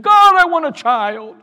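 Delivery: loud, high, drawn-out plea; crying out in prayer. Acted. Woman begging God in the temple, crying out. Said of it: "weeping and crying and saying".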